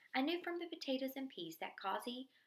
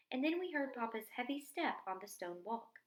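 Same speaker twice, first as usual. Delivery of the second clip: ordinary delivery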